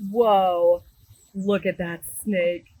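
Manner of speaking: ordinary talk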